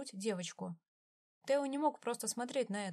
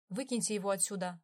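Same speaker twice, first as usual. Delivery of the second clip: холодный приказ. A narrator's reading. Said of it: "отдал приказ Виктор".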